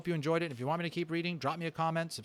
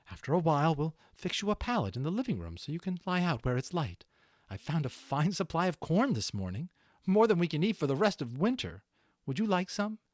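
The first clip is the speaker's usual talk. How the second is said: higher and louder mother mouse, cheerful to comfort her sick son; a mother cheering up her sick child, pitched up and louder; caring mother mouse; 7 semitones higher, 6 dB louder than usual. Acted. Read-aloud story, mother mouse speaks.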